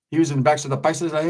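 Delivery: ordinary talk, nothing marked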